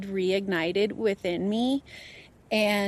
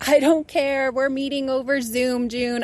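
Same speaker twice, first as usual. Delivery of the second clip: louder and higher, refusing to skip; determined declaration; 5 semitones higher, 5 dB louder than usual. Retold or imitated. Guest retelling insisting on a Zoom session while sick, loud and determined. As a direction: insist on it, no excuses. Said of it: "I was like".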